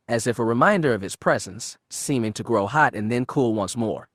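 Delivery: usual speaking voice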